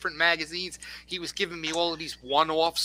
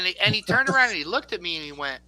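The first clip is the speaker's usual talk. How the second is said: normal talking voice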